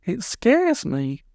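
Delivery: put-on small girl pleading, high and slow; slow, high childlike plea; high little girl voice. A narrator's reading. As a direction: voice Polly as a timid little girl. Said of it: "said Polly".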